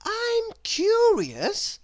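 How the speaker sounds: put-on high, slow wondering girl voice; a curious little girl asking sweetly; little Miss Curious voice. Narrator voices Miss Curious asking her door. Character voice.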